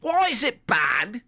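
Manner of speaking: high, slow mock British accent; slow high British put-on. Shown as a demonstration. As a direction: stretch it out in a silly British accent. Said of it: "Is that better?".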